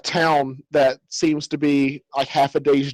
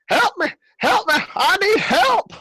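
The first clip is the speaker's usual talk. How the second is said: very high, squealed cry for help; panicked old man. Acted. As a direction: plead frantically in a piercing falsetto. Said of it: "in a panic state".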